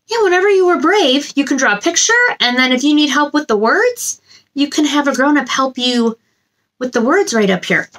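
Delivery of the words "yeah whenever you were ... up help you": ordinary talk, nothing marked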